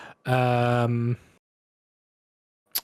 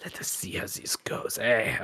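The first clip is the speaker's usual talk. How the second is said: put-on French-accented gambler. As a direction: settle in to play, French-accented.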